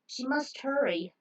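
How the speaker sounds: usual speaking voice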